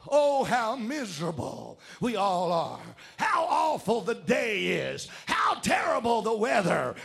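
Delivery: mock wailing complaint, building; miserable lament. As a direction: drag out the woes, ending in a long groaned cry. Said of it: "sing the old song".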